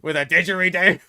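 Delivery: loud, high hammed-up Aussie accent; mock Aussie accent. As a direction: shout it like a rowdy Aussie bloke. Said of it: "Tipping a bit into Aussie".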